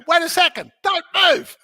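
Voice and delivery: high, loud sudden halt; startled call out. Retold or imitated. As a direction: stop yourself mid-sit in alarm. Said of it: "I say".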